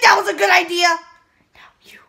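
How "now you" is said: barely audible whisper. Real, not acted. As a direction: whisper the cue. Off-camera cue.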